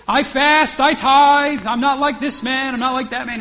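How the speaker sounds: lightly hammed-up proud Pharisee listing his deeds; boastful Pharisee. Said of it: "you can imagine, here he is".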